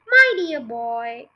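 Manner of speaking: loud, drawn-out exclamation; slow, emphatic father's cry; exclaiming cry. A narrator's reading. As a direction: cry it out grandly, stretching the words. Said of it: "cried Mister Cle".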